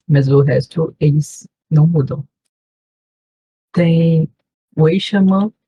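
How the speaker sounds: normal talking voice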